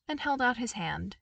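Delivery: usual speaking voice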